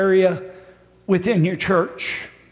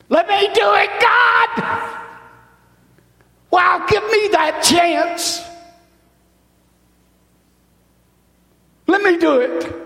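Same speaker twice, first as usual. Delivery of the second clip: eager pleading shout; 10 semitones higher than usual. Acted. Preacher voicing an eager volunteer crying out to God, high and pleading. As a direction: cry out to God, desperate to be chosen.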